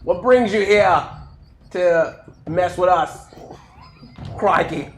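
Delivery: slow, put-on Australian accent. Greeting a captured attacker in Australia, hammed-up Australian accent. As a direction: lay on an Australian accent with long pauses. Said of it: "the best Australian accent I possibly can do".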